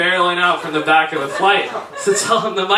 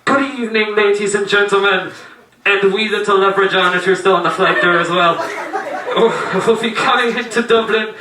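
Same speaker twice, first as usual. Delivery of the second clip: cheery Irish pilot's cabin announcement. Retold or imitated. Pilot addressing passengers into Dublin, imitated Irish accent. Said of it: "the pilot comes on the loudspeaker".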